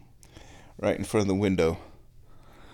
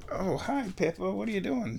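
high, soft voice for the cat; pet talk; 9 semitones higher, 7 dB quieter than usual. Real, not acted. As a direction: coo at the kitty. Greeting the cat.

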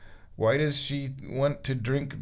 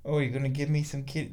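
usual speaking voice

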